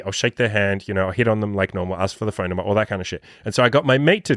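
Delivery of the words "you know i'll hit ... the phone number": ordinary talk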